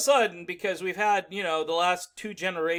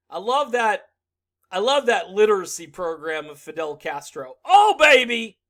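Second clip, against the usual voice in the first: louder, hammed-up adoring voice; mock gushing Bernie; 9 dB louder than usual. Mocking Bernie's praise of Castro, hammed-up and loud. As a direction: gush over it, then holler.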